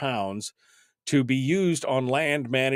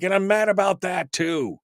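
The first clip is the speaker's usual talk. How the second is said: mock indignant; 8 semitones higher than usual. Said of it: "And I'm like".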